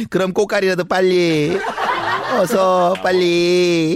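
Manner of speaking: loud, very slow urgent begging. Acted. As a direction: drag it out, desperate. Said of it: "너무나도 다급한 목소리로".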